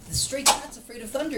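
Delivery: put-on a touch of a worried boy; lightly voiced boy. Parent voicing Jonathan for child, a hint of worry. Boy's line in story.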